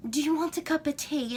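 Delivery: lightly put-on English accent; playful mock-English tea invitation. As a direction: try on a fancy English accent. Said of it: "I love English accents".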